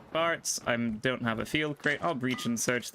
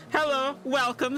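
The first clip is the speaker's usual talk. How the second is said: bright stream greeting; 12 semitones higher than usual. Real, not acted. Streamer greets viewers at start.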